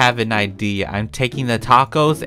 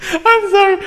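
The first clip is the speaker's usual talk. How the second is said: very high, loud, helpless laughter in the words; laughing apology; 5 dB louder than usual. Real, not acted. Prankster apologising after killing the lights, squealing with laughter.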